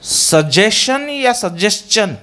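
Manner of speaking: first सजेशन with "shun", second सजेशन with "s-chun"; slowly, सजेशन as "su-je-shun", then "su-jes-chun"; contrasting two pronunciations. Shown as a demonstration. Teacher quizzing students on two options, contrasted. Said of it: "it means it is not suggestion".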